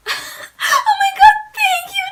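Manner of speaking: very high, tearful shriek of relief; screaming. Retold or imitated. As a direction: shriek your thanks, nearly crying. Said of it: "I literally screamed".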